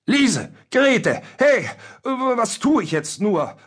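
very high, flustered calling; aufgeregtes Rufen. A narrator's reading. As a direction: call for the maids in a high-pitched panic. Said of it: "Aufgeregt rief er nach seinen Mägden". (aufgeregt nach den Mägden rufend)

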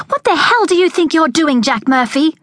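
high, angry demand at Jack; furious high voice, shrill with fright. A narrator's reading. Veronica rounds on Jack, angrily demanding. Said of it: "she demanded".

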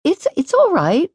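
high, stammered, gently reassuring; girl kindly reassuring an embarrassed boy. A narrator's reading. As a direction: soothe him, a little flustered. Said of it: "Susanna said reassuringly".

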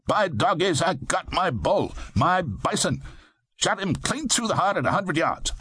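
put-on high, gusty Eastern accent; gusto Eastern accent. A narrator's reading. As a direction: crow it proudly in an Eastern accent. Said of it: "greeting with gusto in what I gathered to be an Eastern accent".

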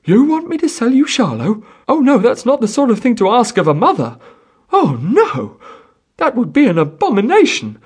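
high, loud, outraged mother; furious. A narrator's reading. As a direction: protest indignantly at full voice. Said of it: "The woman had risen to her feet, furious".

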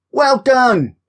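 put-on high young voice; child voice. A narrator's reading. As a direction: voice a young wolf cub. Child character voice.

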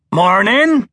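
sunny country greeting; cheerful. A narrator's reading. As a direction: friendly hail between mouthfuls. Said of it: "he said cheerfully".

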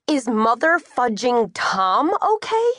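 slow, high, indignant echo; incredulous outrage. A narrator's reading. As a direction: throw the question back, rising in pitch. Outraged echo of brother's question.

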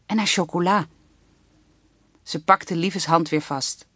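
plain reading voice

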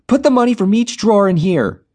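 high, loud demand; yelled. A narrator's reading. Jenny yelling at the cashier during robbery, loud high shout. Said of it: "Jenny yelled".

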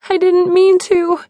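very high, tearful wail; sobbing. A narrator's reading. Narrator collapsed in Phil's arms, high acted sob. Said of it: "I sobbed".

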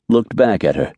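ordinary delivery

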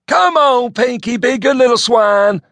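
high, loud country bumpkin call; hillbilly Elmo voice. A narrator's reading. Elmo hollering at the pig in a high country twang.

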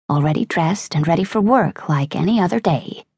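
ordinary delivery

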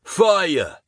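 high, loud battle cry; screamed order. Acted. Sergeant screams at his men to fire. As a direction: yell the order to open fire. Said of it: "screamed Wagoner".

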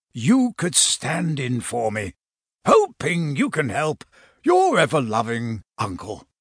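put-on affectionate old Santa asking a favour; Santa Claus's letter.

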